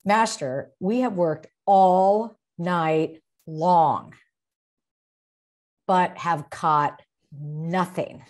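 slow, loud, weary emphasis on every word; emphasized reading. Shown as a demonstration. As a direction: lean hard on the exhaustion, slowly. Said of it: "I would really emphasize it".

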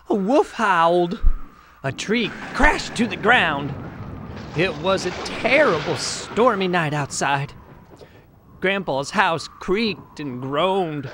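ordinary delivery

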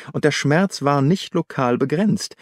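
ordinary delivery

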